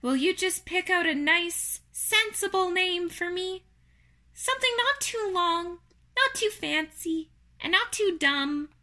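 high little spider voice; third little spider. Acted. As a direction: make a careful request in a small, high voice.

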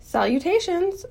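high, sing-out little greeting; small, high-pitched hello; thin voice. Acted. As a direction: pipe up from an unseen perch. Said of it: "he heard again the thin voice".

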